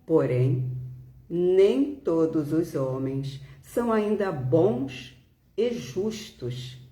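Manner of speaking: ordinary delivery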